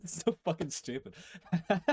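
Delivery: laughing through the words. Real, not acted. Laughing at his own French pain impression. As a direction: giggle as you say it.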